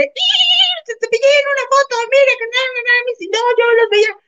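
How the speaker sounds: very high, excited squeal; starstruck fan. Retold or imitated. A fan braking his car to greet the clown, high and thrilled. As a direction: gush like a fan who can't believe it. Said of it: "otro cuate se enfrenó así de".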